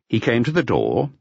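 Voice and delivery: ordinary delivery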